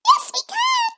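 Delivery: very high, tiny squeak; squeaky creature voice. A narrator's reading. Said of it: "squeaked the Gleeks".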